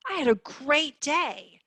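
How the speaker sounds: high, loud, delighted exclamation; happy inflection. Shown as a demonstration. Therapist shows how inflection changes meaning, upbeat and emphatic. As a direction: say it like the day was fantastic. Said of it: "changing my inflection and my emotion".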